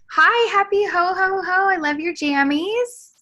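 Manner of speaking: high, delighted festive hello; gushing greeting. Real, not acted. Gushing greeting over festive pajamas.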